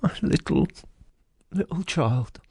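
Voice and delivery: loud, halting cry of grief; father's voice breaking apart. A narrator's reading. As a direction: give way to grief mid-phrase. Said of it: "He broke down all at once".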